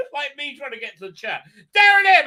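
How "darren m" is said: very high, loud shouted greeting; calling out. Real, not acted. Streamer greeting someone in the chat, loud high call. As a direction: holler the name excitedly.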